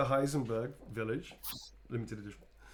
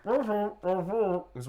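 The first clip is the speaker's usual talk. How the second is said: character voice; 9 semitones higher, 6 dB louder than usual. Acted. Character voice for drawing.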